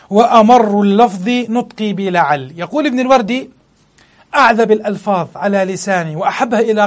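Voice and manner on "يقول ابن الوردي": ordinary talk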